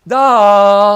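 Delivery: da stretched long, high and loud; long sung-out da; drawn-out da. Shown as a demonstration. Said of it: "as long as you like".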